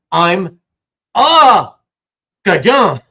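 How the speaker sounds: "a Cajun" where they should hear "Acadian", the "a" punched; misheard as a Cajun. Shown as a demonstration. Explaining to the chat how Americans misheard Acadians, a loud punched "a". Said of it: "thought they were saying".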